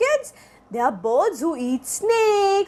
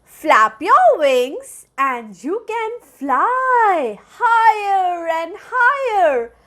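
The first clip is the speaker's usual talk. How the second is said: usual speaking voice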